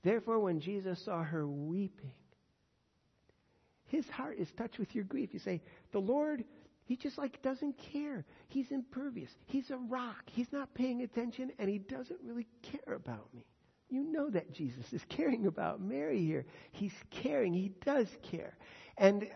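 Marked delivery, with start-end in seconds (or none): 5.9-13.4 higher-pitched mock grievance; acted complaint. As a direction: voice a believer complaining about being ignored. Voices a complaining believer.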